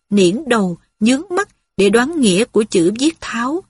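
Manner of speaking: ordinary delivery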